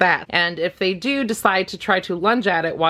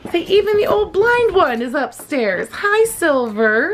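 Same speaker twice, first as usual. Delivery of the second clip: excited greeting; 11 semitones higher, slower than usual. Real, not acted. Greeting the dogs who came in, high excited pet talk. Talking to the old dog.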